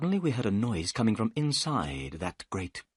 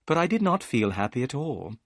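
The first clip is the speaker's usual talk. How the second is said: ordinary delivery, nothing marked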